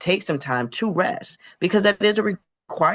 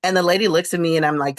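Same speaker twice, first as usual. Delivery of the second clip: ordinary talk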